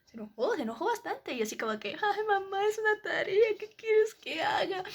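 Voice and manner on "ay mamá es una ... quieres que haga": whiny plea. Retold or imitated. Whining back at her scolding mother.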